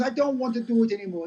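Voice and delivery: high, put-on Jamaican old woman; crap Jamaican accent. Seventy-year-old owner giving up, high mock Jamaican accent. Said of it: "This is my crap Jamaican accent".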